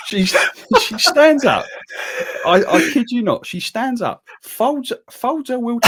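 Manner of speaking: high, loud, incredulous laughing retelling; can barely tell it for laughing; laughing in disbelief. Real, not acted. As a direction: squeal it out through helpless laughter. Telling the absurd moment while cracking up.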